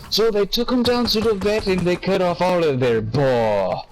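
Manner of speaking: a lightly high sung-story cat line; a hint of a sly showman cat; Cat in the Hat voice. Retelling the song to a friend, lightly high cat voice. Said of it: "And he goes".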